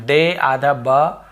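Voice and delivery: very slow, piece by piece; slow syllable-by-syllable spelling; spelled out slowly. Shown as a demonstration. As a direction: break the word into its parts slowly. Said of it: "ऐसे पढ़ते हम".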